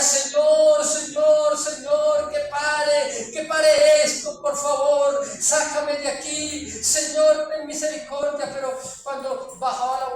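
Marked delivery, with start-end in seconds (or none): 0.0-8.5 loud, desperate cries for mercy to the Lord; screaming plea. Retold or imitated. Burning man begs the Lord for mercy, screaming. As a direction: cry out from the fire, begging. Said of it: "él gritaba y decía".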